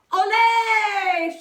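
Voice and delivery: high loud cheering shout. Acted. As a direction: shout it like a whole crowd. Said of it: "shouted the crowd".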